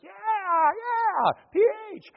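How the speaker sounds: high-pitched crowd yell; crowd cheering. Retold or imitated. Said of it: "everybody's like".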